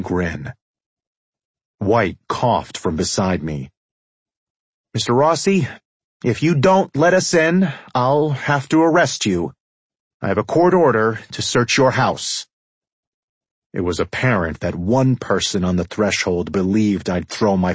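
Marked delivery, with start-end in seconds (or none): 4.9-12.5 high-pitched, ineffectual official making a threat; nervous police chief. A narrator's reading. Police chief threatening the mobster with arrest, nervously official. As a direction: assert authority in a higher, uneasy voice.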